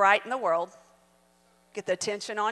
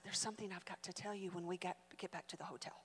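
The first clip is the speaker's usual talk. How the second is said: low, hushed and quick; hushed secret; 5 semitones lower, 17 dB quieter, faster than usual. Retold or imitated. Mother telling her daughter a secret in a hushed voice. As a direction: pass the secret on under your breath.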